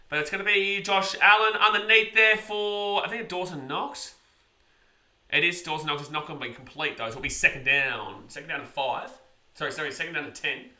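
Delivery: live sports call of the play; play-by-play commentary. Acted. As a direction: narrate the game as it happens.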